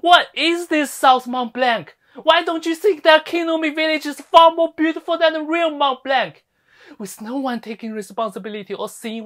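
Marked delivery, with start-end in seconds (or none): 0.0-6.4 loud, raised-pitch scolding; indignant rebuke. Acted. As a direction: shame them at high volume. Berates villagers over lost pride.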